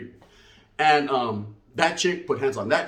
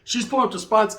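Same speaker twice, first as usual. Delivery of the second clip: ordinary talk, nothing marked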